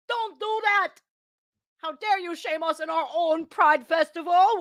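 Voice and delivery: high, hammed-up parody of the nun's outrage; mocking imitation. Vlogger parodying the nun for viewers, high mock outrage. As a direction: mimic her indignant "how dare you" for laughs.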